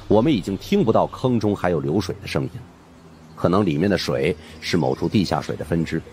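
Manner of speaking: usual speaking voice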